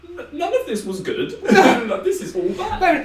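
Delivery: high-pitched laughing protest, voice squeaking up; laughing disbelief. Retold or imitated. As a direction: laugh it out in mock horror. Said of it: "And I was like".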